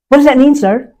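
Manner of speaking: high, loud startled question; alarmed question. Retold or imitated. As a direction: asks in shock. Confronting the colonel, retold.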